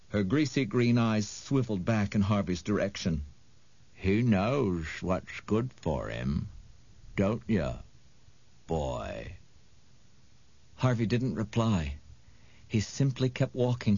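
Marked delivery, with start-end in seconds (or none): 3.9-9.4 low, slow, sly menace; oily witch voice. A narrator's reading. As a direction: tease the boy low and greasy. Mar the witch speaks.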